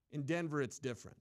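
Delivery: usual speaking voice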